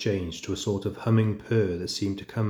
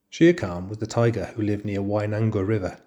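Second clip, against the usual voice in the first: plain reading voice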